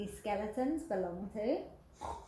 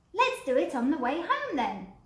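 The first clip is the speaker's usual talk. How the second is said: higher, louder little one; eager suggestion; little skeleton voice; 6 semitones higher, 7 dB louder than usual. Acted. Little skeleton keen to scare someone, higher. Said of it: "said the little skeleton".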